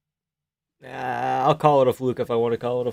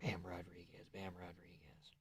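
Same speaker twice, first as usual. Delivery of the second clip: whisper; 6 semitones lower, 29 dB quieter than usual. Real, not acted. Whispering the name to himself.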